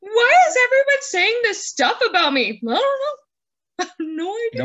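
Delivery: lightly voiced hurt husband; mock indignant husband. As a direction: play the husband hearing the gossip. Playing the mocked husband.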